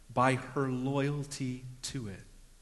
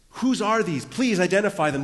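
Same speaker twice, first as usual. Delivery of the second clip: high, loud insistent demand; emphatic examination; 7 semitones higher, 8 dB louder than usual. Acted. Tamar confronting her father-in-law, emphatic and insistent. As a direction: demand an answer, punching the words. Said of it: "this, this emphatic examination".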